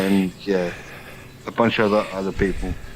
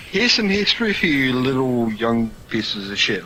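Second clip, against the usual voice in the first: ordinary delivery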